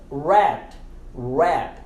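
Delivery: "rat" with T unreleased; silent final T. Shown as a demonstration. Teacher models last word, "rat" with silent T. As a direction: stop "rat" short without a T pop. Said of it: "Não pronunciamos o T".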